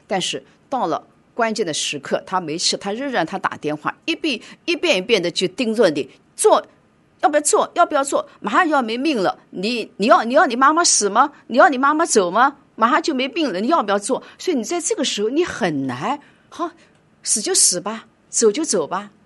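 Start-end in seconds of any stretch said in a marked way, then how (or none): 6.4-14.2 much higher and louder, pressing and insistent, fast on the repeats; urgent doctor. Retold or imitated. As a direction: imitate a doctor pushing hard for a decision. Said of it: "他的那个语气，那种急迫". (模仿医生急迫逼问的语气)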